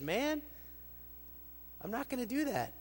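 Jesus' reply. Acted. Jesus answers the man.

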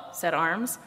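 plain reading voice

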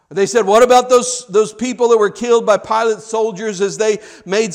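emphatic preacher's delivery; forceful preaching. Real, not acted. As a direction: press the questions and answers home.

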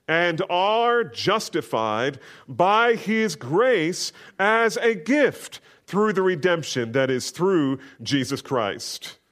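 slow, lifted scripture recital; solemn Bible reading from the pulpit. Acted. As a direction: read the verse with weight, stretching it out. Reads scripture aloud.

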